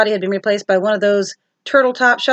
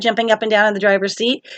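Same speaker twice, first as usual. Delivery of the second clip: ordinary delivery, nothing marked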